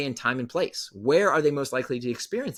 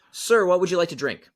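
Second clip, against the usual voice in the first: mock polite attendant; 6 semitones higher, 4 dB louder than usual. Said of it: "And she says".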